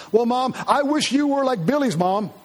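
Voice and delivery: a hint of a sassy child; mock bratty child. Child comparing his mom to another in a mock argument.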